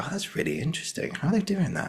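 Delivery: a soft, high marvel at a stranger's gesture; fascinated observer. Retold or imitated. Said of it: "they go".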